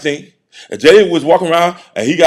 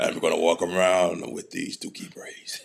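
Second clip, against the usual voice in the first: slow sly whisper, much softer; mock whisper; 11 semitones lower, 11 dB quieter than usual. Mocking a player keeping his braids, in a slow whisper. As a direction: hush it like a stubborn kid.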